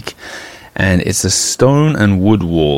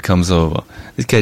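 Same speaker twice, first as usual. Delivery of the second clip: ordinary delivery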